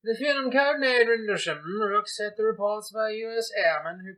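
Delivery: high, loud Cockney newsreader; Cockney accent. Pretend. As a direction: read it in a hammy Cockney accent. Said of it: "with my Cockney accent".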